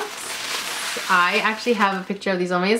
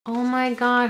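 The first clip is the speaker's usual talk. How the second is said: ordinary delivery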